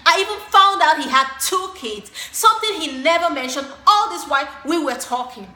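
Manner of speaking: loud, emphatic indignant rant; mad. Acted. Telling a friend about the date's lies, mad. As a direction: hit it hard, fuming at being lied to. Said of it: "I was so mad".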